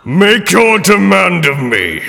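commanding genie voice; grandly granting a wish. Acted. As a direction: demand it grandly. Genie speaks.

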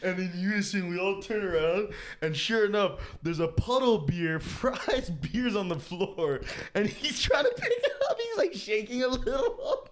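high, cracking up through the story; laughing while talking. Real, not acted. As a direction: struggle to tell it through bursts of laughter.

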